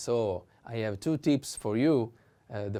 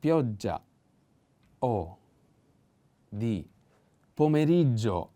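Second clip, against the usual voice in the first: teacher spells out the stress word by word; word stress shown. Shown as a demonstration. Teacher shows students the accent in pomeriggio. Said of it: "The accent is".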